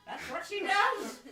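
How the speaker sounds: high, loud laughing delivery. Real, not acted. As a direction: blurt it while cracking up. Cracking up at the joke.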